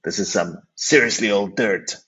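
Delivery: put-on retelling how a partner coined the wine's name, in an American accent; thick American accent. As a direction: do Phil's thick American accent, lightly mocking. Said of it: "he speaks about it with his accent and he says".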